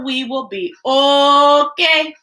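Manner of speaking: usual speaking voice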